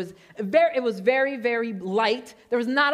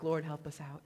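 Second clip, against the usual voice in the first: mock prayer; 6 semitones lower, 11 dB quieter than usual. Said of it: "praying for patience".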